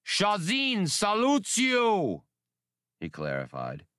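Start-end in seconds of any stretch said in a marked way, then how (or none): 0.0-2.2 high, slow, drawn-out alien salute; high drawn creature salute, head thrown back; rolling creature call. A narrator's reading. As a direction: call it out across the water like a great beast. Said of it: "issued an oscillating call".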